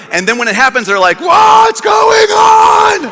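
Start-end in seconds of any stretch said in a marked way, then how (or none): 1.2-3.1 high, drawn-out comic shout; freaking out. Retold or imitated. Disciples shocked when it all happens, freaking-out shout. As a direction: wail it out in mock panic. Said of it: "they freak out".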